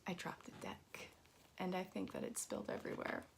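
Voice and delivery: hushed whisper under breath. Real, not acted. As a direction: whisper it quickly. Dropped cards mid-stream.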